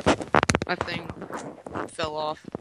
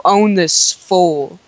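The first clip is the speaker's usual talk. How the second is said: slow hype call; 12 dB louder, much slower than usual. Real, not acted. Hyped battle call.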